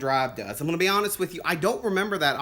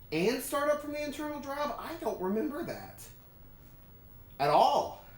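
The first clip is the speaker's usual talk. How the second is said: normal talking voice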